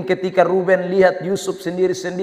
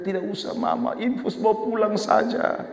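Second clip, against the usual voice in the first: soft little plea, far quieter than usual; lightly voiced child refusing help; sick child pleading; 7 dB quieter than usual. Child begs Mama to keep the drip, soft pleading. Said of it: "Dia bilang".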